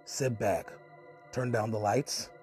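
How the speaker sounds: ordinary talk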